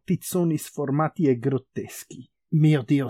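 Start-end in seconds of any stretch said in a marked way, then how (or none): none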